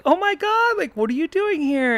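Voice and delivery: high, squealing fake surprise; mock surprise. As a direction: play up the shock of the chance meeting. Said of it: "And Alex was like".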